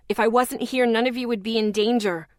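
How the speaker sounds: usual speaking voice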